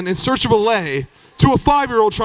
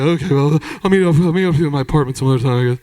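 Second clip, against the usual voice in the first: low, fast mumbled parting words; low talker; 7 semitones lower, faster than usual. Retold or imitated. Jeff saying goodbye to the departing group, low mumble. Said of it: "Jeff was a low talker".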